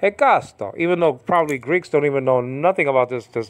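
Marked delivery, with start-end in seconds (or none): 0.0-0.8 higher-pitched Greek Hekasto, falling after KA. Shown as a demonstration. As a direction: give it a Greek lilt. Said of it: "like, like, like a Greek".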